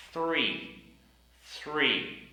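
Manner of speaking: Three with a clearly blown th; Three, tongue between the teeth; corrected th in three. Shown as a demonstration. Teacher giving the correct Three. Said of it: "we need to pronounce the T H sound".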